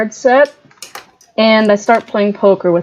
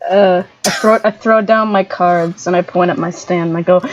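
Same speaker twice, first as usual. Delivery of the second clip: ordinary talk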